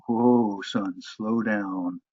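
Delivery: a slow, easygoing mock Southern host; slow-talking host. Radio host telling the fast-talking guest to slow down, slowly. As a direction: stretch it out like an unhurried radio host. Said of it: "a member of the host said".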